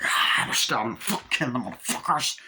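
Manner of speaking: lightly growled vampire taunt, rough; mock vampire snarl. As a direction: play a vampire gloating mid-fight. Voicing vampires in retold scene.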